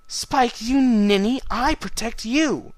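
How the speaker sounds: high, loud exasperated scolding; indignant exclamation. Acted. Twilight scolding Spike for thinking he protects her, high and loud. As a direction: scold him like a fed-up big sister.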